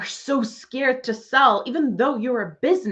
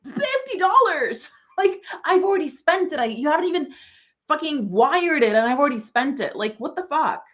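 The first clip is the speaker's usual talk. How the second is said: incredulous mock reply; 4 semitones higher than usual. Mocking her fifty-dollar clients, in high laughing disbelief. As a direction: scoff at the cheap clients' demands. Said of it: "I was just like".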